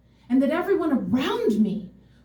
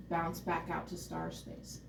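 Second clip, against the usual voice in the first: much softer, floating; soft murmur back to star space; hushed refrain; 5 semitones lower, 16 dB quieter than usual. Real, not acted. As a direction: drift it out gently. Refrain of the regression.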